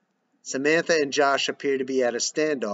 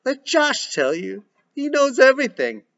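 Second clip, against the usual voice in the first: between anger and sobs; 12 semitones higher, 5 dB louder than usual. Acted. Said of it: "between anger and sobs".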